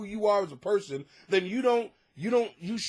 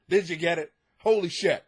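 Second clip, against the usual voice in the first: ordinary talk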